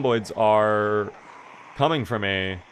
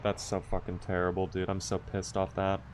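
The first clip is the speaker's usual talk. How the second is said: much softer, grumbling under breath; muttered annoyance; 4 semitones lower, 11 dB quieter than usual. Real, not acted. Streamer to chat after the crash, grumbling softly. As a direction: grumble it to yourself.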